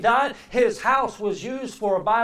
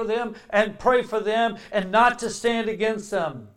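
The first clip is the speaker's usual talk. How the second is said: loud, ringing preacher's voice; preaching at full force; 3 semitones higher, 8 dB louder than usual. Acted. Preacher urging the congregation to stand with Israel, loud. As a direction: call the church to stand with Israel.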